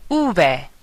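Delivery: ube said "OO-beh"; Spanish letter name. Shown as a demonstration. Teacher giving another name for V, careful Spanish pronunciation. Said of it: "you can also say".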